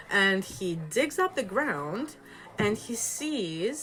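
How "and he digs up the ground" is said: ordinary delivery, nothing marked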